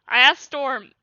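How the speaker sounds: usual speaking voice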